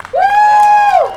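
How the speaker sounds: high, loud rally whoop; cheering whoop. Real, not acted. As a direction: whoop to get the crowd going. Cheering crowd on for caller.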